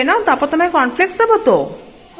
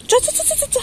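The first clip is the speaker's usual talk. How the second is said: 1 high sing-song urging; 13 semitones higher, 4 dB quieter, much faster than usual. Real, not acted. Urging child along.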